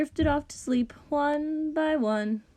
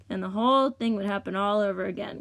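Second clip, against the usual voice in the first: ordinary delivery, nothing marked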